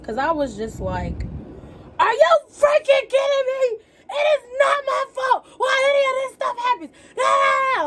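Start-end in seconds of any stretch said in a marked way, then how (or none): 2.0-7.8 high-pitched, tearful outraged yelling. Retold or imitated. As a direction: blow up into the phone, voice way up, ending in a scream. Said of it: "I'm just yelling on the phone".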